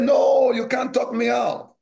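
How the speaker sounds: they won't be talked out of giving; insistent shout. Retold or imitated.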